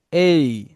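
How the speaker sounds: "এই" said loud as a model. Shown as a demonstration. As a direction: repeat "এই" for the class. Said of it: "আমরা বলবো এই". (শেখানোর ভঙ্গিতে "এই")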